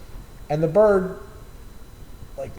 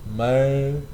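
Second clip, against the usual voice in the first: lightly voiced laid-back stoner, slowed way down; a hint of a lazy, unbothered guy savoring weed; Jimmy's slow stoner drawl; much slower than usual. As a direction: stretch it out like a mellow pothead coworker. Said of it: "that's how Jimmy talked".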